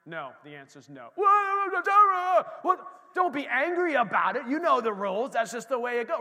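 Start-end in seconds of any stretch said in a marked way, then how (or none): 1.2-6.2 scolding parent. Pretend. Parent scolding child over screen time.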